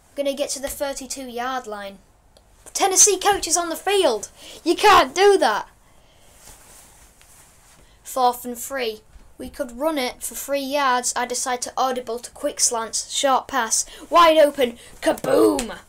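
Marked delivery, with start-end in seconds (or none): 2.7-5.7 high, loud disbelief; surprised outrage. Real, not acted. Coach runs onto the field. 14.1-15.8 loud hype call; excited. Real, not acted. Big completion.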